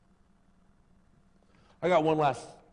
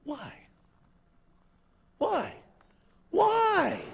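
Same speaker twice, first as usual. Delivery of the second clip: pleading why, climbing each time; rising whys; much slower than usual. Real, not acted. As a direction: build the why up to a peak. Pastor building rising questions.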